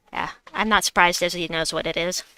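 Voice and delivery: ordinary talk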